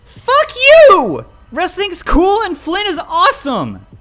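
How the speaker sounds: very high, full-force scream; furious screaming. Acted. Child defending friend to father, screaming in fury. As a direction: scream back in defiance.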